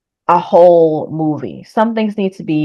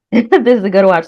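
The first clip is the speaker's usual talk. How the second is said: said through a laugh, louder and higher; amused, laughing endorsement; 4 semitones higher, 5 dB louder than usual. Real, not acted. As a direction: laugh your way into the line. Laughs while repeating her verdict.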